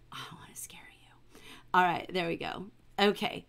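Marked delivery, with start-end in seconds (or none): 0.1-1.4 whisper. Real, not acted. Too close to the camera.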